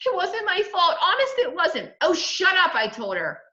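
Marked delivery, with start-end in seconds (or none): 0.1-1.9 put-on high, upset girl's plea; crying out in protest. A narrator's reading. Sheila defends herself after the fall, high distressed cry. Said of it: "Sheila cried". 2.0-2.7 high, louder annoyed brush-off; irritated retort. A narrator's reading. Brother silencing Sheila's excuses, irritated and loud. Brother snaps at the blamed girl.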